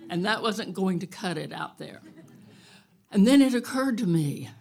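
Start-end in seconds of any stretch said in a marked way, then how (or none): none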